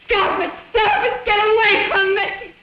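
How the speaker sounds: high frantic screams; hysterical screaming. Acted. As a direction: scream in panic and fury. Woman screaming, struggling.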